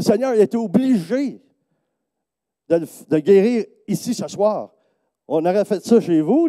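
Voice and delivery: high, loud, excited protest; voicing the woman's eager answer; excited reply. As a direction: burst out with joyful conviction. Sabine's excited reply retold.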